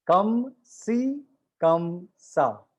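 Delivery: कम सी कम सा drawn out very slowly, syllable by syllable; slow demo. Shown as a demonstration. Teacher slowly spelling out कम सी कम सा for students. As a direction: stretch each syllable of कम सी कम सा. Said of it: "okay, okay".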